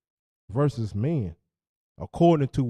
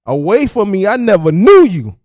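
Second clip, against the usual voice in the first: much higher and louder stern rebuke; Yahusha's rebuke; 8 semitones higher, 18 dB louder than usual. Retold or imitated. Speaker quoting Yahusha rejecting the callers, loud stern rebuke. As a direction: cast them away in a loud proclamation. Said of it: "You know what Yahusha told him?".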